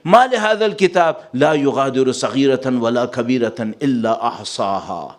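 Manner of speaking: normal talking voice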